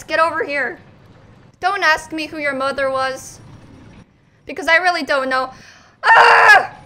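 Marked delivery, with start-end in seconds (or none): 6.0-6.8 loud, high scream; alarmed scream. Real, not acted. Player sees Thomas stuck under cart, alarmed scream.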